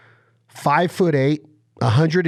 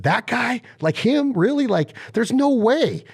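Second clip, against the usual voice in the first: high, incredulous mock gasp of surprise; retold disbelief; 10 semitones higher than usual. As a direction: act stunned that the sweet guy is a warrior. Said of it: "I just looked at him and went".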